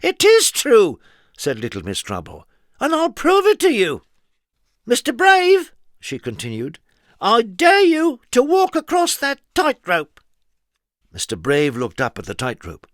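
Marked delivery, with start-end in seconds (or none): 0.0-1.0 high, loud, slow insistence; mischievous girl. A narrator's reading. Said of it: "Trouble insisting". 2.8-5.7 put-on mischievous girl. A narrator's reading. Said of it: "Trouble challenging". 7.2-10.2 high, loud taunting dare; daring. A narrator's reading. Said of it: "Trouble daring Brave".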